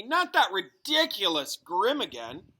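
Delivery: loud, slow, fed-up groan of a line; exasperated. A narrator's reading. Hermione scoffing at the seer's prophecy, loud and exasperated. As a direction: drag it out in disbelief.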